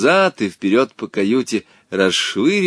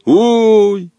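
plain reading voice

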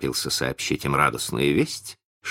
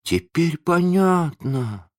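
high, slow drawl; long-drawn realisation in a high voice; 12 semitones higher, slower than usual. A narrator's reading. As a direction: stretch the words out as it sinks in. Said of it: "протянул Задира".

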